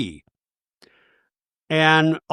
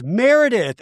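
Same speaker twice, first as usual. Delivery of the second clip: calling out; 8 semitones higher than usual. Retold or imitated. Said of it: "Angie goes".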